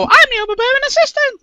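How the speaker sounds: high, fast fussy assistant; overbearing assistant voice. Acted. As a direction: squeak it out like the assistant. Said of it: "I often hear him just go".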